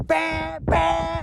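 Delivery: high honking bah sounds; imitated car horn. Mom and uncle honk to wake him, high mock honks.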